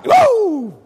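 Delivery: high, loud, excited "woo"; shouted whoop. Retold or imitated. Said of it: "Just shouting".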